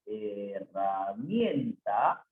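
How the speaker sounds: "herramienta" low and very slow, he-rra-mien-ta; syllable by syllable. Shown as a demonstration. Teacher working through the sentence, "herramienta" slowly sounded out. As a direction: draw out each syllable of "herramienta".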